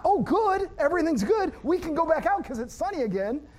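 high, sarcastic fake relief; mock cheer. Mocking the idea of being fine after the boat fixed, sarcastic and high. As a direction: chirp it like forced optimism. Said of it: "It's not".

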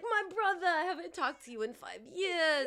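high, loud gushing welcome; overjoyed to see his brother again. Retold or imitated. Brother greeting brother at breakfast, high gushing joy. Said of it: "He's like".

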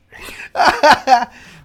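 high, loud burst of laughter; big laugh. Real, not acted. Laughs after accent joke.

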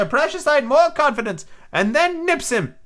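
put-on high, excitable old castaway; squeaky marooned sailor, eager and odd; lightly cracked high pirate voice; Ben Gunn voice. Ben Gunn's voice in the story.

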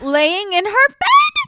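high, stretched-out disbelief; shocked. Real, not acted. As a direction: ask it in total shock. Shocked at scene.

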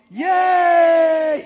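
loud, very high held yay; happy cheer. Shown as a demonstration. Preacher rehearsing the happy emoji response, loud happy cheer. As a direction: belt it out long and high. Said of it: "Happy emoji".

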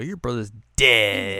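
loud, drawn-out taunt; mock taunting shout. Kids taunting a girl about her brother, loud mock jeer. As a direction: jeer it slowly, hammed up.

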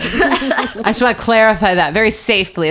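loud, amused correction through laughter; laughing clarification. Real, not acted. Mother clarifying she handed son over safely, laughing. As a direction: blurt the disclaimer while laughing.

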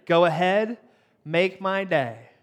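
"Go ahead, make my day." with "make my day" drawn out slowly; slow menacing Clint Eastwood delivery; slow tough-guy line. Shown as a demonstration. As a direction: hold back, then crawl through "make my day". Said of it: "So hear me out, right? Are you ready?".

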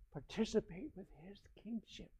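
hushed, breathy aside; whisper. Real, not acted. Preacher drops to a whisper before the congregation. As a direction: drop to a sudden whisper. Sudden whisper mid sermon.

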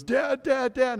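imitating a tearful, high cry of Dad; a daughter calling out in tears, mimicked; retold crying daughter. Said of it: "and she's just crying".

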